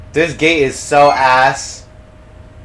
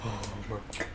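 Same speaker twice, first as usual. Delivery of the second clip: much softer, hushed and breathy; frightened hush; scared whisper; 8 semitones lower, 18 dB quieter than usual. Real, not acted. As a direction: whisper so the monster won't hear. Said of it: "I'm fucking scared".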